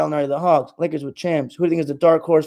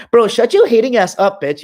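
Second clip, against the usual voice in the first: raised, louder snap at a commenter; angry outburst; 6 semitones higher, 5 dB louder than usual. Real, not acted. Streamer snapping at a hating commenter, loud and angry. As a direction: tell the hater off hard.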